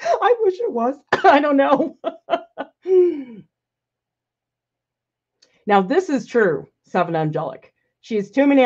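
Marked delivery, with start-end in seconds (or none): 0.0-3.4 giggling through the words, voice jumping up; laughing. Real, not acted. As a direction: laugh it off, amused. Laughing at viewer's forehead joke.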